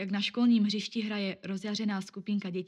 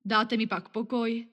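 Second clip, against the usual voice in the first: grudging question; 3 semitones higher, 6 dB louder, slower than usual. Acted. Weary man gives in.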